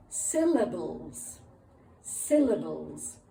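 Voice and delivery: "syllables" spelt out slowly in three beats; slow clear word. Shown as a demonstration. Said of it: "We call them".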